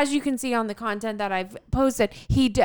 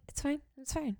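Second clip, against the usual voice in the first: much softer, clipped reassurance to herself; tight-lipped fine; 3 semitones higher, 13 dB quieter than usual. Retold or imitated. Telling husband it's fine after the missed video, much softer. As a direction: swallow the anger and say it small. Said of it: "this is what I'm saying out loud".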